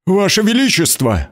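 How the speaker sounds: high cry with a breaking voice. Acted. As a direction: cry out, voice cracking. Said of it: "вскрикнул он срывающимся голосом".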